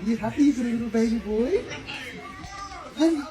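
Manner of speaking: high, loud sing-song pet voice. Real, not acted.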